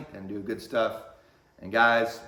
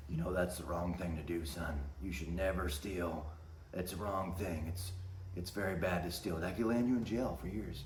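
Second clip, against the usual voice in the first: softer, lower polite lecture; polite manager; 4 semitones lower, 10 dB quieter than usual. Retold or imitated. Manager politely scolding the boy, soft and low. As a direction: lecture the kid quietly. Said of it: "trying to be polite thing".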